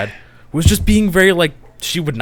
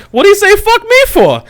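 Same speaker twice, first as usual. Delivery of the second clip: high, louder mock [name] line; fifty Cent quote; 8 dB louder than usual. Comparing the waitress to the meme, a loud mock [name] line. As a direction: throw the famous line in a put-on voice. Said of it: "the fifty Cent, like".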